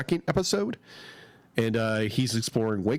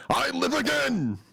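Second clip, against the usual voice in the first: imitating a character's line; 11 semitones higher than usual. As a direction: declaim it like a revived cartoon creature. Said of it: "the character says the line".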